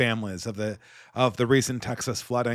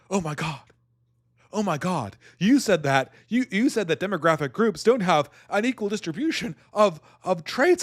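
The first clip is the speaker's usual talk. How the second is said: rising mock shriek, higher and higher; mock hysterical outrage; 5 semitones higher than usual. Streamer mocks opponent's outrage at his facts, rising shrill. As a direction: ham up a scandalized gasp. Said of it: "he'd be like".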